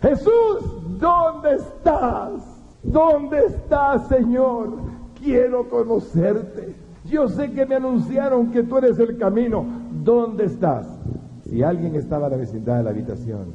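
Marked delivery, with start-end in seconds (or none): 0.0-10.9 high, slow crying out to Jesus; desperate shouting. Retold or imitated. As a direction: call out to him in anguish, pleading. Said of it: "comencé a gritar".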